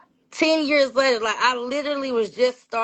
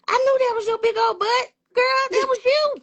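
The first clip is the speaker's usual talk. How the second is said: very high-pitched, exclaiming granny; delighted granny; 13 semitones higher, 4 dB louder than usual. Retold or imitated. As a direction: imitate granny crowing in recognition. Granny's delighted recognition, acted out.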